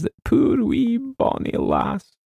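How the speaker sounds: high, slow, weepy Scottish agent; tearful Scotsman. Acted. Agent weeping to the author over the girl. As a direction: snuffle through the memory of the girl. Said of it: "dabbing at his eyes".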